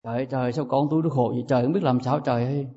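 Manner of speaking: quick, soft mock lament of worried parents; fretting parents. Parents fretting over their suffering child, hushed and quick. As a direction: rush it out under the breath like a fussing mother. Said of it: "Thì cha mẹ nói sao?".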